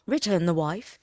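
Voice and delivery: ordinary delivery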